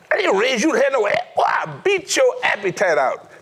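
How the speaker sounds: high, fast threat of a strict father; a strict father warning his son; stern dad. Retold or imitated. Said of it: "That was his phrase".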